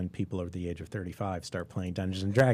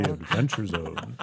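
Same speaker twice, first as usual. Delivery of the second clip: scary announcer voice; 3 semitones lower than usual. Retold or imitated. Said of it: "You have to say it like that".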